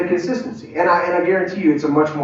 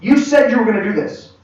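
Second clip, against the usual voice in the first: raised, higher-pitched accusation; confrontational; 7 semitones higher than usual. Acted. Leader holding someone to their word, confrontational. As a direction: call them out on a broken promise.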